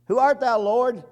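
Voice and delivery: high, loud startled question; startled cry. Acted. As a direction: call out in awe to the light. Paul calling out, startled.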